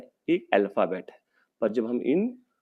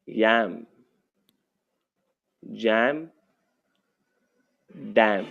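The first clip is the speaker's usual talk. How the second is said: words read slowly with long pauses; teacher reading word list aloud; 3 semitones lower, much slower than usual. Shown as a demonstration. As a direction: read each word out for the class to follow. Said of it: "मैं मेरी तरफ से पढ़ रहा हूँ".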